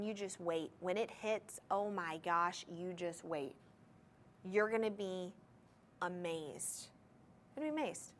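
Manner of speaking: soft, low teasing promise; hushed build-up, as if awestruck; hushed awe. Real, not acted. Seller building awe over the sparkle.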